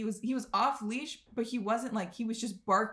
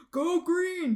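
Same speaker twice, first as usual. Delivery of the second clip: higher, louder campus cheer; mock yell; 9 semitones higher, 5 dB louder than usual. Imitating a guy yelling a school cheer on campus, mock yell. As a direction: call it out like a school chant. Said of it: "randomly yell out".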